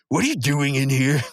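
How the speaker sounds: gruff Muscle Man. Retold or imitated.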